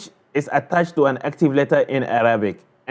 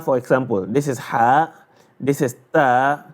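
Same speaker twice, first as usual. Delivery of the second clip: ordinary talk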